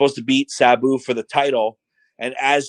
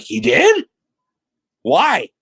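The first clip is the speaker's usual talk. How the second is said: high rising question, then a drawn-out one; baffled surprise; 9 semitones higher, 4 dB louder, much slower than usual. Retold or imitated. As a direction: replay your own astonished reaction. Teller replays own baffled reaction.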